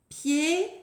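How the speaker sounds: "Piéton" with pié long and rising, long pause, ton; Piéton stretched into two widely spaced syllables; syllable counting. Shown as a demonstration. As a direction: count out "Piéton" slowly together. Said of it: "On compte les syllabes ensemble".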